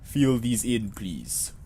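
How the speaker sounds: put-on Russian accent, falling away softly; faux Russian accent. Clerk handing over forms, faux Russian accent. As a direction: give an order in a fake Russian accent. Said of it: "a, faux Russian accent".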